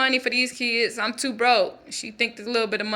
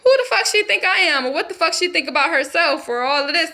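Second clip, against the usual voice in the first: indignant outburst; 5 semitones higher, 4 dB louder than usual. Retold or imitated. Husband fuming at his wife's remark, high indignant outburst. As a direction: pitch jumps way up, louder and outraged. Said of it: "And then I'm like".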